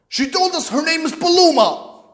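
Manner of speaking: high, indignant accusation; angry father. Retold or imitated. Father repeating the girlfriend's name to his son, indignant. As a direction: throw the name back at him, high and outraged. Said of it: "I know the tone in his voice".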